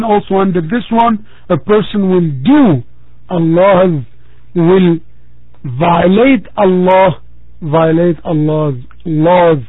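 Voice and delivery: ordinary delivery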